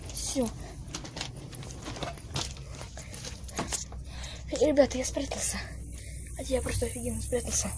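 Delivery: hiding whisper. Real, not acted. Hiding, talking to viewers.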